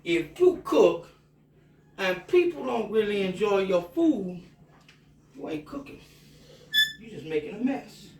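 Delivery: lightly mimicking an old Cajun cook; a hint of Justin Wilson's drawling storyteller voice; Justin Wilson quote. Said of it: "Justin Wilson used to say".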